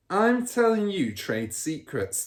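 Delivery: put-on a smug car dealer bragging to his kid; Mr Wormwood's voice. A narrator's reading. Father character voice.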